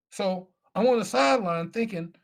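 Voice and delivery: ordinary talk, nothing marked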